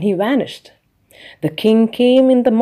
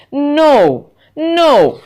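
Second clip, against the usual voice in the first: high, louder impish little man; little man's voice; 6 semitones higher, 5 dB louder than usual. A narrator's reading. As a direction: tease her like a sly little imp. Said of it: "the little man said".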